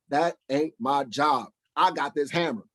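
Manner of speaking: normal talking voice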